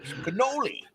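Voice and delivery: high, mock Italian New Yorker; mock New York accent. Joking about a friend from Queens in a high mock New York accent. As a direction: toss it off as a hammed-up Queens local. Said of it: "Jace is from, the Queens".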